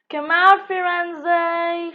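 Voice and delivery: loud, high call into the bushes; shouting. A narrator's reading. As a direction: call out loudly as if searching. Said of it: "shouted Legs".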